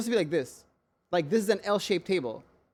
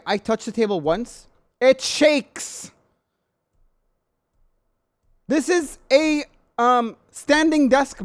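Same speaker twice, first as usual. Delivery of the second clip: raised, high-pitched indignant complaint; loud exasperation; 6 semitones higher, 5 dB louder, slower than usual. Real, not acted. Complaining that the standing desk shakes, exasperated. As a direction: punch it out in disbelief.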